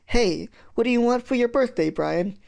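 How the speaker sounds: high-pitched coaxing of a brother. Acted. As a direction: try to win his attention. Coaxing his chanting brother.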